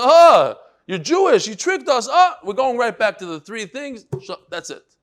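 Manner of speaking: loud, high, outraged yelling; caught-you rage at the rabbi; furious shout. Acted. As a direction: bellow it like you've been fooled. Romans discover the trick.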